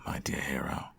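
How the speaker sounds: breathy whisper of "my dear hero"; whispered endearment. Acted. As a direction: lean in and whisper it. Teasing the hero.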